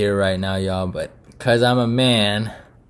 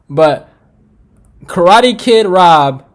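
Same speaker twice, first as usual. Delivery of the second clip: higher, louder, slow call; slow loud plea; 6 semitones higher, 8 dB louder, much slower than usual. Real, not acted. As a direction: plead with him, drawing out the name. Pleading call to Whitaker.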